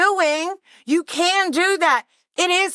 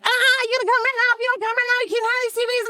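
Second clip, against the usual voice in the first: higher, urgent and pushy, a bit fast; the seller on Facebook pressing her to come right now, in a higher, pushy voice; 8 semitones higher, faster than usual. Retold or imitated. Seller voiced in retold story.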